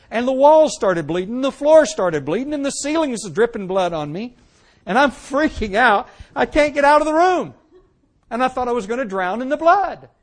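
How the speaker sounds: excited retold fear; rising panic. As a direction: let the terror build as you tell it. Retelling the terrifying trip.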